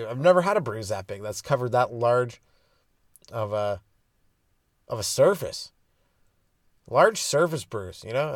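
normal talking voice

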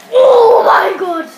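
slow, loud, stretched-out gasp of relief; 1 drawn-out cry. Real, not acted. Gamer crying out at a close call, slow and loud.

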